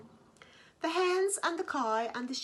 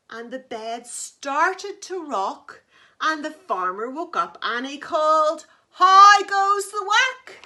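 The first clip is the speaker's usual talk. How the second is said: louder and louder till the farmer calls; building to a shout; 5 semitones higher, 14 dB louder than usual. A narrator's reading. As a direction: swell from rocking to the farmer's shout. Bed rocks, farmer wakes calling.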